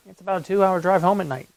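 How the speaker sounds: usual speaking voice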